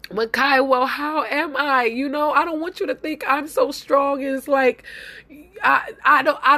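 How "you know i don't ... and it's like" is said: usual speaking voice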